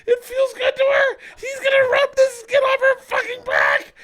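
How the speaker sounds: high-pitched shrieking laugh through the words, yelling; howling laughter. Retold or imitated. Dad kicking the uncle, howling with laughter. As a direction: squeal the words out while cracking up. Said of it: "the hardest I've ever seen them laugh".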